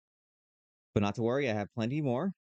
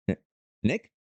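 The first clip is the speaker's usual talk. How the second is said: questioning call; 4 dB louder than usual. Acted. Player waking in a dank cave, questioning call for Nick. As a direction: call out as a question, unsure anyone is there.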